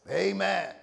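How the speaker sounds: a drawn-out American "Amen"; American accent. Shown as a demonstration. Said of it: "That's my American voice".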